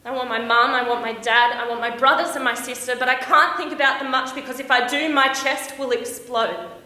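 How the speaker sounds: loud, rising homesick outburst; mounting distress. Acted. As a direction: build in urgency towards bursting. Homesick panic building after betrayal.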